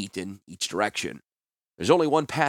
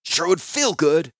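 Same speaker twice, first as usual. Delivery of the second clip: slow, higher, loud and vengeful; angry hiss; 7 semitones higher, 5 dB louder, slower than usual. A narrator's reading. Captive answering the healer's plea, angry hiss. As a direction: relish the revenge, drawn out. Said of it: "Klars hissed".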